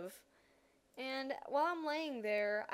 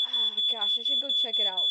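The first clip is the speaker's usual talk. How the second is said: reluctant; 10 dB louder than usual. Retold or imitated. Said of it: "But I was just like".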